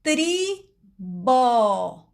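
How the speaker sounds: "tribó" dragged out very slowly; teacher stretching "tribó" for readers; sounding out. Shown as a demonstration. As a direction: pull "tribó" apart so the TR is heard. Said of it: "ó, T R I".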